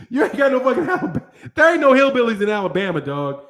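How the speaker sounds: fast, laughing through the words; real laughter breaking through. Real, not acted. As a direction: rattle it off while cracking up. Laughing at his own claim.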